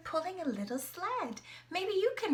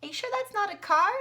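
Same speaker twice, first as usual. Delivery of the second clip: mock surprise; 8 semitones higher, 8 dB louder, faster than usual. Playful mock doubt.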